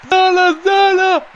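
high loud name calls; excited cheering. Real, not acted. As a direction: cheer the player. Cheering player.

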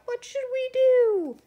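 very high, loud cry; children crying out. A narrator's reading. Children over the puddle by the campfire, crying out. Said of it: "The children cried out".

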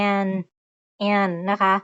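an, clear open vowel; an said clearly. Shown as a demonstration. Teacher demonstrating how an is pronounced. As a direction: say an like the start of Anna. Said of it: "ส่วนตรงนี้ออกเสียง".

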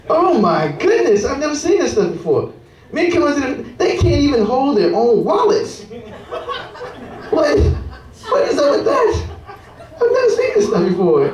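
high, hammed-up amazement; mock astonishment. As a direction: play up the disbelief.

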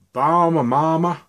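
high, loud, hammed-up South American accent on Bahama Mama; a cheesy put-on South American accent, sung out; bad South American accent. Vlogger naming a juice in a mock South American accent. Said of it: "That was a really bad sort of Southern South American accent".

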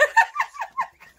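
loud high burst of laughter. Real, not acted. Laughs after out of breath.

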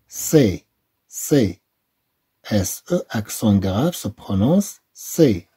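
slow syllable drill, "ce" said clearly; slow sound drill. Shown as a demonstration. Teacher drilling French syllables with pupils, slow. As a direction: model each syllable slowly.

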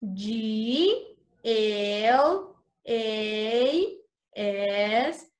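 letters spaced out slowly; slow spelling. Shown as a demonstration. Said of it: "Let's spell glass".

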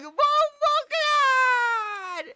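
high, loud, stretched exclamation; shocked drawn-out cry. Real, not acted. Streamer reacting to a photo of a man, long high shocked shout.

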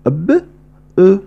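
ب then أو, each separately; sounds apart. Shown as a demonstration. Teacher splitting the syllable: ب, then أو. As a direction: say ب, pause, then أو. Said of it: "فإنني أنطق".

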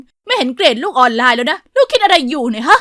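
loud, hard scolding from mum; firm, loud, angry mother; stern mother. A narrator's reading. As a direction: snap at your child over bad grades. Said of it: "แม่ก็พูดเสียงแข็ง".

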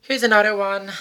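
ordinary talk

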